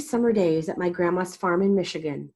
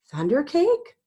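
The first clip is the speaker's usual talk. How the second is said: high, louder startled question; a touch of a stammer, pitched up in surprise; 9 semitones higher, 5 dB louder than usual. A narrator's reading. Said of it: "I stammered".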